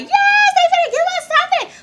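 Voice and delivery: loud, high squeal of excitement; ecstatic cheer. Retold or imitated. Fan seeing the stage start to move, ecstatic. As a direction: scream it with a laugh in the voice.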